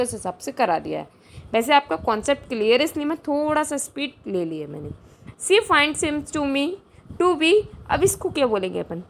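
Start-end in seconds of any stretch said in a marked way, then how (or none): 5.5-7.6 slow, deliberate sentence reading; slow dictation read. Shown as a demonstration. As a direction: read it word by word for students. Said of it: "reading exam sentence slowly".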